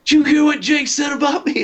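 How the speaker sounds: put-on outraged singer shrieking in a New Jersey accent; imitated New Jersey accent screech; New Jersey accent scream. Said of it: "in his New Jersey accent".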